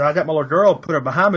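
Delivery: ordinary delivery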